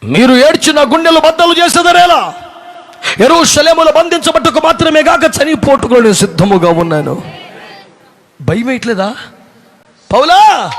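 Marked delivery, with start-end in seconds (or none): 0.0-7.3 bold declaration. Acted. Paul's defiant words, preached. 10.1-10.7 calling out. Acted. Prophet calling Paul.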